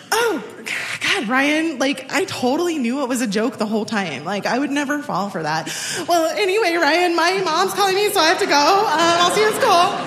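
high, bright fake nonchalance, hiding hurt; forced breezy cover. Teen girl fakes calm on the phone to Ryan, high and breezy. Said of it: "But I gathered my wits and I said".

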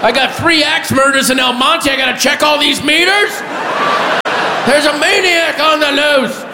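high, hammed-up urgent yelling; frantic mock panic. Parking officer playing homicide cop, high frantic yelling. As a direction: yell it like a city-wide emergency.

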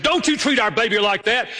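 high-pitched, urgent scolding; indignant retold outcry. Mother protecting her baby from her husband, imitated indignation.

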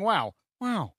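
drawn-out wondering wow; Owen Wilson impression. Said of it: "Owen Wilson saying".

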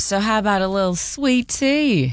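a touch of a bad Southern accent. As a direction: ham up a Southern accent on sweet tea. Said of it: "try to have a southern accent".

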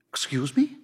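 shocked. A narrator's reading. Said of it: "her voice shocked".

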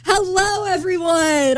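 high-pitched hello to the room; mock cheery greeting. Lone American greeting a silent party, high mock greeting.

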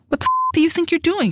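high, sharp furious outburst; furious demand. A narrator's reading. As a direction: she hurls the question at him in fury. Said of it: "she demanded".

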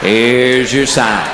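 slow drawn-out Here's your sign, your stressed; slow punchline. Acted. Comic to the audience, slow deadpan punchline. Recurring punchline delivered slowly.

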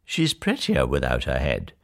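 low, softly pleased prince; quiet, dark satisfaction; smiling. A narrator's reading. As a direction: say it low with a smile. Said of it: "He smiled and said".

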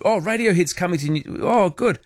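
high, fast excited gasp of joy; retold delight. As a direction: squeal over the good news. Retelling hearing Radiohead news.

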